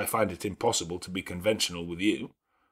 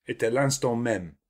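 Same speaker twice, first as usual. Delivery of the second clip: put-on eager French girl; 2 semitones higher than usual. A narrator's reading. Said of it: "cried she".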